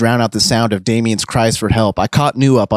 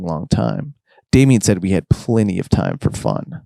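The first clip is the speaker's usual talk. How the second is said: usual speaking voice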